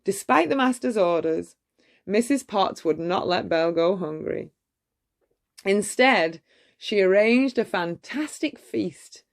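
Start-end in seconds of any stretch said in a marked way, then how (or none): none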